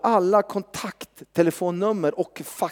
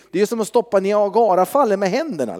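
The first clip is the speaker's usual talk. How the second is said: ordinary delivery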